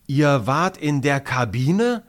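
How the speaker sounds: put-on boy bursting out in envy; blurted out. A narrator's reading. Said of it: "platzt es aus Justin heraus".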